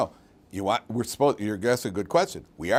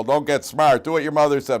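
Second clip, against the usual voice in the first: mock yell, louder and a bit higher; father yelling; 3 semitones higher, 7 dB louder than usual. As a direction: retell dad snapping at his son. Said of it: "And my father would yell".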